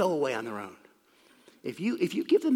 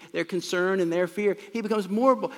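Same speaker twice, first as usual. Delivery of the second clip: ordinary delivery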